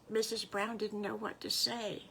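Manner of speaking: plain reading voice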